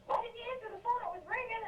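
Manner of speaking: high-pitched imitation of sister; imitating sister. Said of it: "My sister goes".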